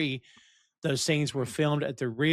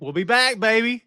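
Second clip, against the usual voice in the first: loud, high hype; real excitement; 9 semitones higher, 8 dB louder than usual. Real, not acted. Podcaster cheers the show's return for season four, excited. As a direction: crow it out like a comeback.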